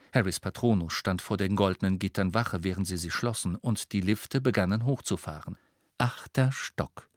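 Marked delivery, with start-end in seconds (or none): 6.0-7.1 slow, detached lift announcement; cool witch's voice. A narrator's reading. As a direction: announce floors coolly. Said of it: "die kühle Stimme der Hexe".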